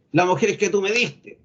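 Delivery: mock angry, contemptuous retort; imitated accusing anger. Preacher voices Adam blaming God, mock indignant. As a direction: imitate an arrogant reader blaming someone. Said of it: "ese tono de enojado".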